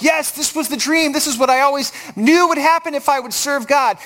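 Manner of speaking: high-pitched imagined thrill; gushing, as if a dream came true; pumped. Pretend. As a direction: play a prophet elated at success. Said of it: "You would expect him to be pumped".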